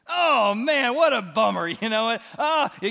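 high, loud, playful bummer; excited exclamation. Real, not acted. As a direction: cry out at the bad luck, amused. Exclaiming about Thomas missing out.

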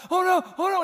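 high, loud mock "oh no"; mock alarm. Preacher narrating the villain's fall, hammed-up mock alarm. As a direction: fake gasp of dismay.

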